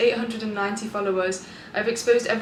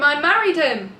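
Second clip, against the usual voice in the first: ordinary talk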